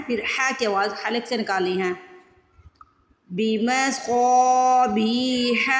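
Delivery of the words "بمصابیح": بمصابیح with a heavy, stretched saad. Shown as a demonstration. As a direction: recite بمصابیح very slowly, each long vowel drawn out. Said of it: "پہلے صاد کو stretch کر لیں". (بمصابیح میں صاد کو موٹا اور کھینچ کر)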